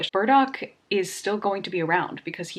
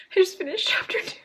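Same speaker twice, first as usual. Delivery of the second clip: quick, higher breathless whisper; 10 semitones higher, faster than usual. Real, not acted. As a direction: whisper it fast to yourself. Overwhelmed aside.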